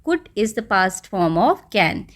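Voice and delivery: very slow, word by word; slow dictation. Shown as a demonstration. Teacher slowly dictating a grammar point to pupils. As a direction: spell it out slowly for the class. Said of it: "teacher dictating slowly".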